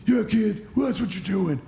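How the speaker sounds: loud, high, strained caricature; mock dismissive boss voice; Johnny Ace impression. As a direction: brush someone off in an exaggerated high voice. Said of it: "That's how he sounds".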